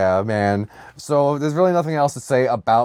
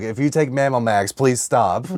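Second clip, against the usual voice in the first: usual speaking voice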